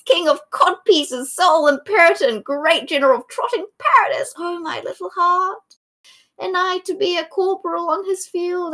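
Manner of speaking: high, wailing Shakespearean lament; hammy stage actor. Acted. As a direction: overact it like a tormented lover. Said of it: "Just banging his head against the grass, going".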